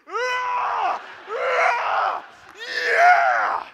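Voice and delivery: high, very loud screaming war cries; savage battle yells. Retold or imitated. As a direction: scream like you're slashing with a sickle. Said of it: "I'm just like".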